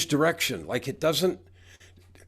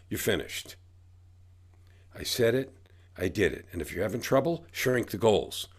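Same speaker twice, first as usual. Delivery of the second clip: ordinary delivery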